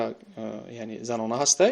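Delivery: ordinary talk, nothing marked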